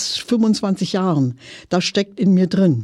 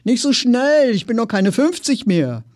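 raised-pitch call after someone, easing off; 7 semitones higher than usual. A narrator's reading. Customer calling after a salesman who walks too fast, calling out. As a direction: call after him higher, then settle down. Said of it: "rief ich".

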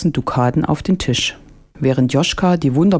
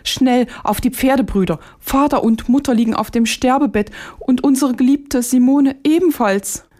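much higher, alarmed urgent call; frightened cry; 10 semitones higher than usual. Acted. Joschka urging his brothers home to dying parents, in an alarmed high cry. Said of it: "so schrie er erschrocken auf".

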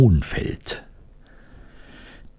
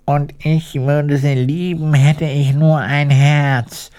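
high, lightly sighing character voice; sighing wish; 6 semitones higher than usual. A narrator's reading. Iron Woodman longing for a heart, sighing. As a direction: sigh it longingly, pitched up. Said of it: "seufzte der eiserne Holzfäller".

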